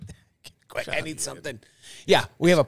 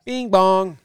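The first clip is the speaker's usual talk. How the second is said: casino chime; 8 semitones higher, 7 dB louder than usual. Pretend. Casino slot machine chime.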